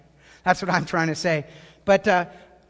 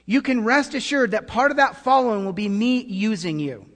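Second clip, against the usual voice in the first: normal talking voice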